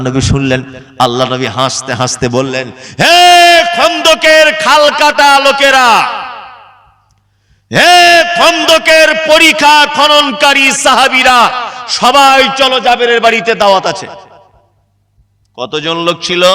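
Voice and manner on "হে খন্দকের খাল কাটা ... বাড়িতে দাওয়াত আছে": slow, high, long-drawn shout to a crowd; public announcement. Acted. The Prophet inviting all the trench diggers, slow high proclaiming call. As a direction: summon everyone with a ringing, stretched-out call. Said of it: "এই এলান করা শুনে".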